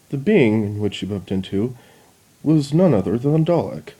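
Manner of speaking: usual speaking voice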